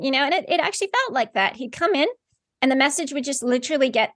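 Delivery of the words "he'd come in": ordinary talk, nothing marked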